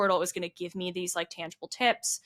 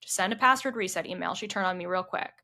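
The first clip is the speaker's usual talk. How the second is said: ordinary delivery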